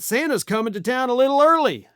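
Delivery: quick, high, hammed-up cheer; mock Sean Duffy. Mocking the transportation secretary's Santa line with a quick, high cheer. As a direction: sing out the promise like a giddy salesman. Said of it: "he said".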